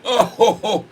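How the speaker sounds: normal talking voice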